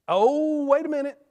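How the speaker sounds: sudden high exclamation, as if it just dawned on him; mock surprise. Preacher to congregation, feigning a sudden realization, loud and high. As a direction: pretend the thought just hit you, loud and high.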